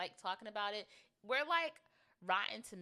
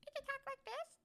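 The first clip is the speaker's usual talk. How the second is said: much higher squeaky voice that doesn't quite come off; failed high voice attempt. Shown as a demonstration. Trying the high voice for listeners with a dry mouth, much higher but not quite there. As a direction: try for the high voice and fall short. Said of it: "I can't fully do it".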